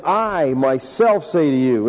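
slow, each word set apart, "I myself" stressed; slow emphatic. Shown as a demonstration. Preacher to congregation, slowly stressing "I myself". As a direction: spell it out slowly, leaning on "I myself". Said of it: "The emphasis here is on the word".

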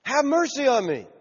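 high-pitched acted cry for mercy; yelled plea. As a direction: call out pleadingly, pitch well up. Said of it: "He just yelled loud".

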